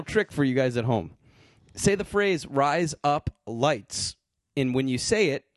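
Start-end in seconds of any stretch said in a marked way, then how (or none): 2.5-4.2 very slow Australian "Rise Up Lights"; slow Australian accent. Shown as a demonstration. Showing listeners at home the trick, slow Australian accent. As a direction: spell out "Rise Up Lights" slowly, the Aussie razor blades. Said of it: "your Australian accent to say the word razor".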